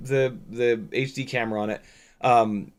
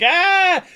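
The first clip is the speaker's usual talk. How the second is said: high, loud mock squeal; sudden high-pitched kid scream; little kids' scream; 6 dB louder than usual. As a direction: imitate an excited child's shriek. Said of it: "Like, little kids will be like".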